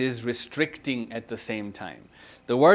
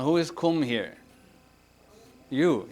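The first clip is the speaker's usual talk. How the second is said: normal talking voice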